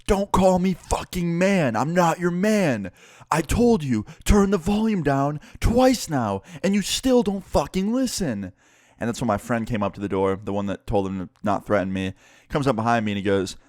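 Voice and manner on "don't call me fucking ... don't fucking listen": high, drawn-out mock yelling; imitated angry scream. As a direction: act out a furious neighbor's tirade without full volume. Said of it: "this dude proceeds to scream".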